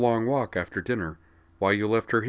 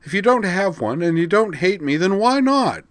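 higher, louder demand; 13 semitones higher, 8 dB louder than usual. Acted. Vigdis, angry and teary, demanding of Corwin. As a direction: demand an answer.

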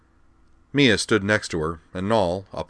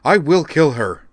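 furious threat; 9 semitones higher, 9 dB louder than usual. Acted. Raging, held back by Dorothy.

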